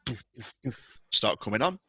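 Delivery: usual speaking voice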